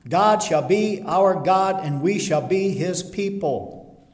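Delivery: loud and slow scripture proclamation; slow, emphatic preaching. Acted. Preacher proclaiming God's promise slowly to the church. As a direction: dwell on each promise with full force.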